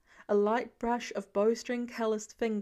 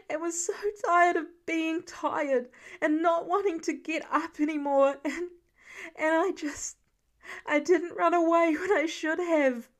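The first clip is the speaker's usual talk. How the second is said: put-on higher, louder voice of a frightened boy; Peter, distraught; 6 semitones higher, 5 dB louder than usual. A narrator's reading. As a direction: plead it as a desperate young Peter. Peter confesses, voiced by narrator.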